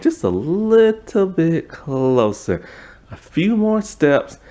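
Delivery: high, slow, coaxing ghostly voice; eerie ghost voice. Pretend. Ghost nurse luring Lauren toward her grave, high and slow.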